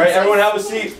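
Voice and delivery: calling out. Real, not acted. Presenter calling the kids to order.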